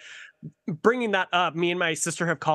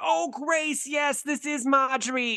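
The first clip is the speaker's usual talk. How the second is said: put-on old lady character; 9 semitones higher than usual. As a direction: quaver it out like a confused granny. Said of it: "I'm like".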